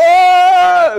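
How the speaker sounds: loud high screech; mock yowl of a panicked cat; cat's shriek. Cat hits the wall.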